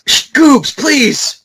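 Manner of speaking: high, loud hush; loud shushing. Real, not acted. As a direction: shush him loudly, pleading. Hushing cohost's off-topic interruption.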